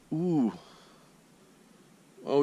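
normal talking voice

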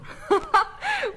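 high, surprised little laugh; bright, high chuckle of disbelief; amused laugh. Acted. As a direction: laugh off the old man's odd worry. Frau Hofmann amused by dream.

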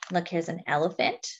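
plain reading voice

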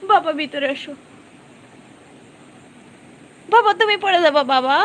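high, loud urgent plea; alarmed warning. Real, not acted. Owner warning Jui it will fall, alarmed pleading shout. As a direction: call out sharply in fear of a fall.

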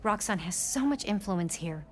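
ordinary talk, nothing marked

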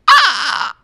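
short, high, loud "ah!"; sudden yelp of mock horror; mock scream. Telling friends about the ambulance bill, mock scream.

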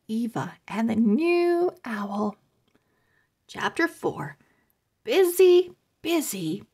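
ordinary delivery

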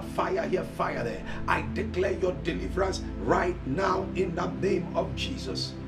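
ordinary talk, nothing marked

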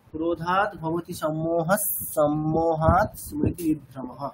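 "সম্মোহস" with a dental s, flowing into "সম্মোহাৎ"; slow chant of the whole line in one breath, sandhi made; joined line reading. Shown as a demonstration. Teacher demonstrating one-line reading with sandhi. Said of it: "যেমন".

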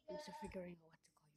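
soft whispered aside to viewers. Real, not acted. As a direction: whisper it off-mic.

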